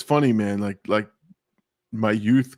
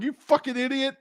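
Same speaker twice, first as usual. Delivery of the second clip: high-pitched mock yell at a dumb sister; 13 semitones higher than usual. Retelling the crash, yelling at his sister in a high mock yell. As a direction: squeal the insult up high in disbelief. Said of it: "I'm yelling at my sister".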